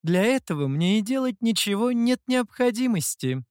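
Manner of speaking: high, teasing taunt; singled-out, high-voiced teasing; mocking mimicry. A narrator's reading. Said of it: "передразнила лисица".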